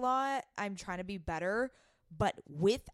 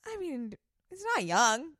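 high, laughing banter; giggling; 9 semitones higher than usual. Real, not acted. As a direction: giggle through the words. Laughing about crush's age.

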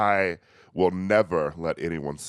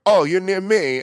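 high, loud eager contestant; excited contestant; 11 semitones higher, 6 dB louder than usual. Retold or imitated. Contestants trading hometowns, high eager mock contestant. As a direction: chirp it like a thrilled islander. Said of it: "and like".

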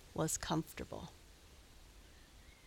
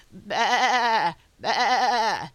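loud, quavering ram baas; deep, strong bellwether bleat; 3 semitones higher, 9 dB louder than usual. A narrator's reading. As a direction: bleat powerfully, like a little clap of thunder. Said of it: "so deep and strong".

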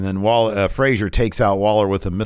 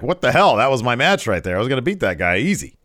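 lightly acted indignant protest, higher and louder; mildly indignant wrestler; 5 semitones higher, 4 dB louder than usual. Frazier retelling his stolen match, mildly indignant. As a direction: imitate an aggrieved wrestler, lightly. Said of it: "So Frazier's like".

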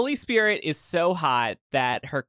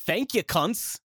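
attempted Australian accent; amateur Australian accent on request; 3 semitones higher, 3 dB quieter than usual. Shown as a demonstration. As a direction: try a line in an Aussie accent. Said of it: "you doing an Australian accent".

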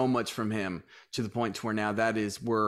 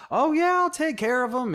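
put-on sweet Southern accent; 13 semitones higher, 4 dB louder than usual. Owner imitating his Southern coworker Lisa, sweet Southern accent. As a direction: mimic Lisa's Southern charm, much higher. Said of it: "her sweet Southern accent".

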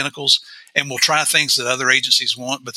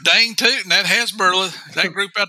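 higher, hammed-up West Virginia twang; 6 semitones higher than usual. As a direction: warn them like a West Virginia old-timer. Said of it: "sound better in a West Virginia twang".

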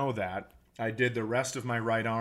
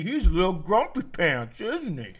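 put-on teasing baby talk; 6 semitones higher, 4 dB louder than usual.

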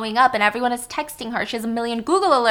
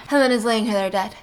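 ordinary delivery

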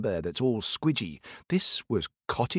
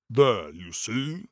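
giant voice; slower than usual. Acted. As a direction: rumble it out like a giant. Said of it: "giant voice".

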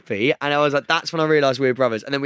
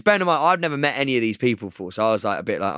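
normal talking voice

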